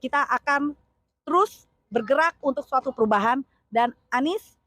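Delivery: loud, punchy campaign oratory; rally the crowd phrase by phrase; rally speech. Real, not acted. Supporter urging fellow supporters toward change, rousing and loud.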